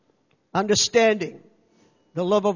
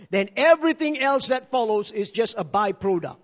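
usual speaking voice

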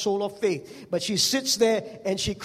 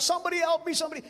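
mock cry for help; 8 semitones higher, much faster than usual. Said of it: "not like she was crying out".

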